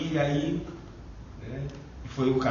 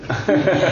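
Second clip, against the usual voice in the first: risada curta; 4 semitones higher, 9 dB louder than usual. Real, not acted. Ri após a piada.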